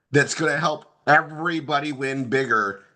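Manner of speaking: usual speaking voice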